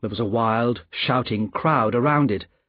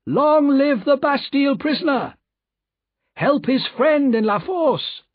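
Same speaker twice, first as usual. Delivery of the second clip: crowd's shouts; 14 semitones higher, 3 dB louder than usual. A narrator's reading. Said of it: "He heard shouts of".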